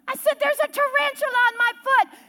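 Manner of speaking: high, strained cry of alarm; acted-out high-pitched panic; panicked reply. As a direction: squeal it up to someone on a roof. Shrieking reply in tarantula scene.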